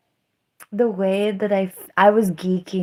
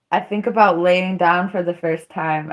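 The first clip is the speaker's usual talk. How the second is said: ordinary talk, nothing marked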